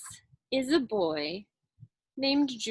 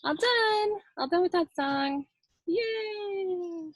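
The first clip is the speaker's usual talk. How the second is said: cheerful; 8 semitones higher, slower than usual. Real, not acted. Teacher cheers end of song.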